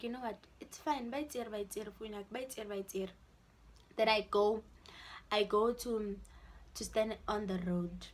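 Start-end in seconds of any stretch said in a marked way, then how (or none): 0.0-3.1 soft, lower resigned murmur; quietly resign to losing the phone; resigned murmur to herself. Retold or imitated. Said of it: "I'm like".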